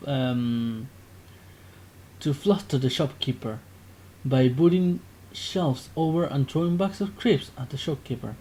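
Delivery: normal talking voice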